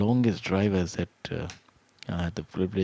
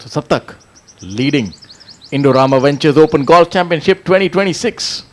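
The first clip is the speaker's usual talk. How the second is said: excited announcing; 7 semitones higher, 15 dB louder than usual. Real, not acted. Announcing the tournament leader.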